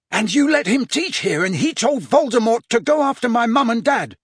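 the anger finally bursts out of him; outburst. Acted. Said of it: "he could not stop himself".

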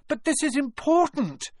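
high-pitched, insistent plea; urgent protest. A narrator's reading. Harry begs McGonagall to listen, urgent protest. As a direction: push back desperately.

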